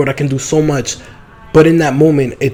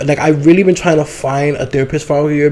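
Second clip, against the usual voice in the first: usual speaking voice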